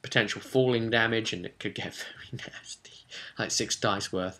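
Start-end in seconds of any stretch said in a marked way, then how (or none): 1.3-3.0 whispered aside. Real, not acted. Aside about falling damage.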